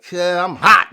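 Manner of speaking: loud heated warning; loud, heated retold warning. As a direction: let them know you're angry. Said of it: "I'm like".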